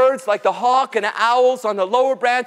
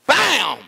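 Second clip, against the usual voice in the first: falling "bam" whack; club whack bam; 3 semitones higher than usual. Acted. Bear whacking the hollow log, punchy bam. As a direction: imitate a club striking wood.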